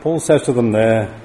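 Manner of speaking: usual speaking voice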